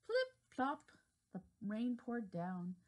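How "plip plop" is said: high playful plip plop. A narrator's reading. Reading to children, bright high raindrop sounds. Sound words in read story.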